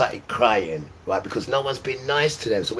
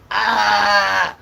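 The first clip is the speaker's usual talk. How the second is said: pained cry; 11 semitones higher, 8 dB louder than usual. Retold or imitated. Boy in pain.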